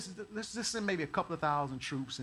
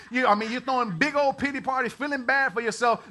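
ordinary talk, nothing marked

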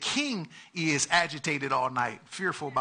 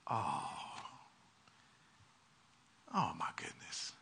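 softer, breathy dismay; dismayed whisper; 11 semitones lower, 15 dB quieter than usual. Retold or imitated. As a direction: whisper it to yourself, stunned. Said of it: "he's like".

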